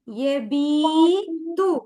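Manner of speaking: യബീതു very slow and loud, long ബീ; drawn-out vowel. Shown as a demonstration. Teacher models the word for pupils, drawn-out vowel. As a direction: hold the ബീ loudly in യബീതു.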